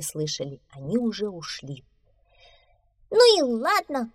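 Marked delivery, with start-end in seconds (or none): 3.1-4.1 high, loud defiant shout. A narrator's reading. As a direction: shout it in a huff. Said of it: "крикнул мышонок". (обиженный крик)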